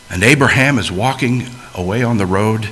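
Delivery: ordinary talk, nothing marked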